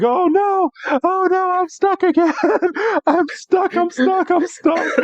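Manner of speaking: high-pitched comic Wailord panic; mock panicked screaming. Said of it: "is still screaming and it's just in so much".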